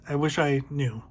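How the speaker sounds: ordinary delivery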